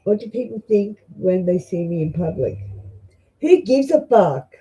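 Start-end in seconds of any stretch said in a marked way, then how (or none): 3.4-4.5 slower, higher, louder blurt of defiance; blunt outburst. Real, not acted. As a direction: snap it out like an Aussie who doesn't care.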